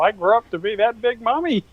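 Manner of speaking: put-on high childlike calf speaking to its mother; little calf. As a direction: pipe up like a small calf looking at its mom.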